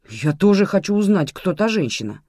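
a touch of protest; indignant. A narrator's reading. Lazo demands to know about the woman, indignant. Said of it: "возмутился Лазо".

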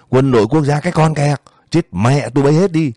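high, angry yell in a narrated quarrel; yelled curse. A narrator's reading. Man cursing the armed guards to their faces, angry yell. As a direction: raise the pitch and curse them out. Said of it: "người đó hét lên".

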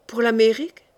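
questioning repeat, pitch lifting at the end; blurted, disbelieving question; involuntary surprised echo. A narrator's reading. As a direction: echo it back as a startled question. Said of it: "répétai-je malgré moi".

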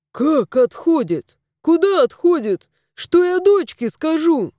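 rising in bewildered panic; flustered. A narrator's reading. Owner hears his cat is dying, flustered. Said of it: "растерялся Андрей".

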